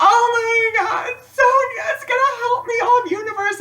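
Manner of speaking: crying out high and loud through tears; overwhelmed happy sobs; sobbing joy. Retold or imitated. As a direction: wail thanks to the universe. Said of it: "I started sobbing".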